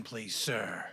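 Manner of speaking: ordinary talk, nothing marked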